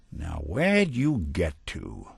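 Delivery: slow searching call. Acted. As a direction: draw it out while hunting for someone. Searching for someone hiding from him.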